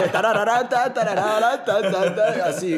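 sing-song the wobbling head, half laughing; playful chant of a drunk bobbing; bobbing head noises. Retold or imitated. Mimicking drunk friend's head bobbing.

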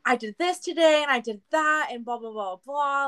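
high, rattled-off list of events; mock long answer. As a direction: parody yourself giving a long rundown. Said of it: "this whole long answer".

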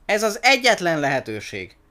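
insistent high, loud exclamation; urgent cry. A narrator's reading. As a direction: press the last chance on them. Giraffe continues shouting to endangered monkey.

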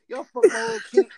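high, loud burst of disbelief; excited outburst. Real, not acted. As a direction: blurt it out, cracking up. Laughing outburst at the others.

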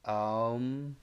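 Aum stretched long into a hum; drawn-out Om. Shown as a demonstration. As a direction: say Aum slowly, holding the sound. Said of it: "chanting of the sounds Aum".

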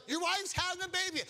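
very high, startled lady voice; alarmed little lady. Acted. Hospital lady realizes the baby is coming, imitated high alarm. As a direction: imitate her jumping in alarm. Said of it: "And the lady jumps out".

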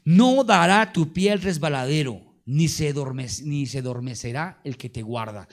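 scripture reading. Acted. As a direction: proclaim the verse to the congregation. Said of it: "Y dice el siguiente verso".

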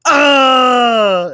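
hammed-up Ace Ventura shout; mock yell. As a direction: yell at your own leg. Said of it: "yelling at his legs".

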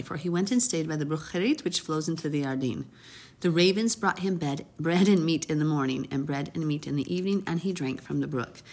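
ordinary talk

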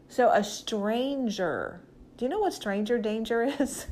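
normal talking voice

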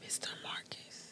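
whispered name, barely audible. Real, not acted. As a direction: breathe the name as a secret. S3 echoes the name in a hush.

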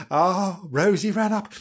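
ordinary delivery

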